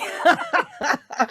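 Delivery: laugh. Real, not acted. Laughing at Gervais joke.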